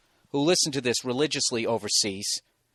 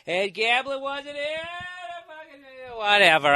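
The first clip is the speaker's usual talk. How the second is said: higher, slow mock voice of an emailer; mocking know-it-all; 11 semitones higher than usual. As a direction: parody the nitpickers, drawn out. Mocking emailers about Vegas history.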